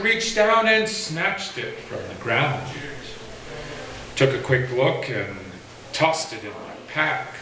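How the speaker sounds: measured, rhythmic poetry reading with pauses; poem recital. Acted. Poet reading his own poem to an audience, measured recital. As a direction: let each rhymed line land, then breathe.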